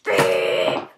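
booming low mock voice on big; hammed-up deep voice. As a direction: drop into a big low voice for the word. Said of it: "It was like".

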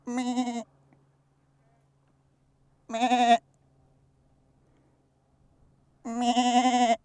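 a soft imitated bleat; quiet playful sheep noise; mock sheep bleat. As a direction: baa back at the sheep.

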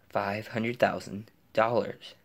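normal talking voice

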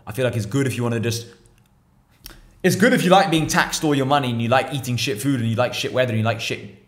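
usual speaking voice

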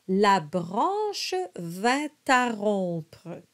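slow, careful French: monta with a nasal O; slow French model. Shown as a demonstration. Coach reads the verse slowly for students. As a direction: sound out each French syllable. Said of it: "We have a lot of nasalized sounds".

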